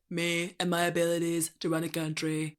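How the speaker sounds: monotone synthetic presidential impression; deadpan robotic boasting; Trump. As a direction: parody politician in an even, AI-like delivery. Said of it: "sounds like a Trump A I voice".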